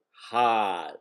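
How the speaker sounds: hard with a dropped R, Boston style; Boston accent. Shown as a demonstration. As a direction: say hard as hah, no R. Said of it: "As in Boston accent".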